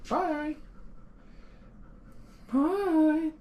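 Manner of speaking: very high cooing goodbye; high sing-song bye. Real, not acted. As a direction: coo it to the pet. Saying bye to the dog.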